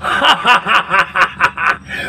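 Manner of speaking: booming Satan laugh; evil cackle; devil laugh. Acted. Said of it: "Sabay hiyaw, Satanas".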